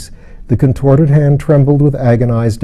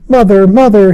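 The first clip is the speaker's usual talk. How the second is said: put-on child calling out high and loud; crying out; 10 semitones higher, 6 dB louder than usual. A narrator's reading. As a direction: cry out to his mother. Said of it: "he cried".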